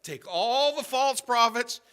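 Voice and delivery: loud, high, stretched-out order; commanding shout. Acted. Prophet ordering the false prophets removed, loud command.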